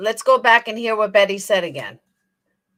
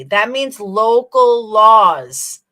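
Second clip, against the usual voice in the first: slow and emphatic; 4 dB louder, slower than usual. Shown as a demonstration. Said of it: "Do you know what that means, Betty".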